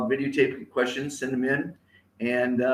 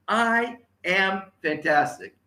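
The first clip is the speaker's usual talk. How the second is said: slow, higher, word by word; measured model phrase; 5 semitones higher, slower than usual. Shown as a demonstration. Speaker repeats phrase for audience to copy, slow and measured. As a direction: spell it out steadily for repeating.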